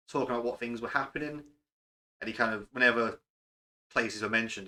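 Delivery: normal talking voice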